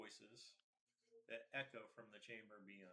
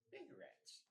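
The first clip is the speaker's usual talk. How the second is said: whispered squeaky goblin; 5 dB quieter than usual. Acted. Nervous goblin in the dark.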